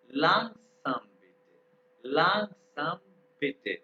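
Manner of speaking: लैंगसम बिट said very slowly. Shown as a demonstration. Teacher models asking him to slow down, very slowly. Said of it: "मैं तेज पढ़ा रहा हूँ".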